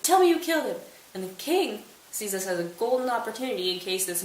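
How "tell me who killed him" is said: high loud vengeful demand; furious demand. Retold or imitated. Laertes to the king, loud furious demand. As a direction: bark the order in rage.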